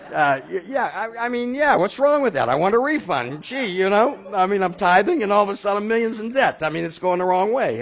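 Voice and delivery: high, loud indignant griping; hammed-up high and loud protest; mock whiny complaint. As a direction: play the aggrieved tither demanding a refund. Mock complaint to God about debt.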